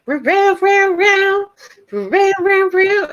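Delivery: high, drawn-out animal yowls; imitated pet noise. Pet owner mimics her pet calling for treats, high animal cries. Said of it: "she's, like, doing that".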